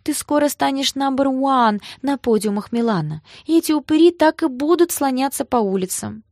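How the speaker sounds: high-pitched, encouraging comfort; soothing a child. A narrator's reading. Sister consoles crying Гоша, babying him. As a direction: cheer him up like a small boy. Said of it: "как маленького мальчика".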